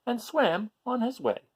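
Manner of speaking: ordinary delivery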